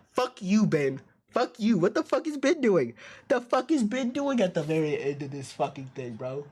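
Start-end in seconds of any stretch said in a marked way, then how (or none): 0.1-5.0 furious yelling. Real, not acted. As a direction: scream your fury at the screen. Raging at a game character.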